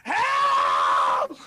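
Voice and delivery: loud, drawn-out scream of help; long, full-force cry for rescue; yell for help. Acted. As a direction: scream it out as a drowning victim. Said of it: "she just yells".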